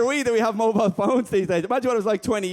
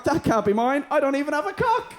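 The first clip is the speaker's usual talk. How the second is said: high mock Ken; 7 semitones higher than usual. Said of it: "Ken standing there going".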